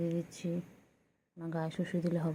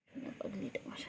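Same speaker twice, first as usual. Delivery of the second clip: whisper; 3 semitones higher, 9 dB quieter than usual. Real, not acted. Cooing softly to the pet.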